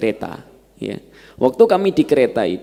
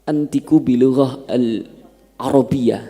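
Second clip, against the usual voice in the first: slow careful Arabic; slower than usual. Retold or imitated. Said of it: "Ya, kata saya".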